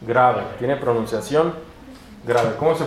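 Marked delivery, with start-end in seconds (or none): none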